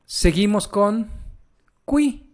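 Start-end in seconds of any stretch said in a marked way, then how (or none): 1.9-2.3 "qui" said kwee, u sounded; Italian qui. Shown as a demonstration. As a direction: say "qui" high, with a w glide. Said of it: "Aquí dice qui".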